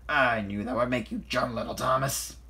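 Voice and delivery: loud, gloating pirate; piratey voice. A narrator's reading. Salty has startled Thomas, piratey voice. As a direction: tease loudly like an old sea dog. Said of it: "laughed a piratey voice".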